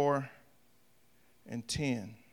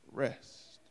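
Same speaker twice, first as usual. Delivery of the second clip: a soft, whispered rest to land the point; hushed whisper; 6 semitones lower, 11 dB quieter than usual. Real, not acted. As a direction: breathe the word rest almost silently. Preacher's hushed final word.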